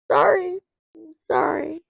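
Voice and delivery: very high-pitched little sorry; small apologetic squeak. Real, not acted. Streamer apologizing after coughing, small high squeak.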